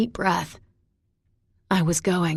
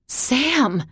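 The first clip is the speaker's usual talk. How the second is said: gasped name, pitched up; shocked gasp; 7 semitones higher than usual. A narrator's reading. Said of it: "hear my name in a gasp".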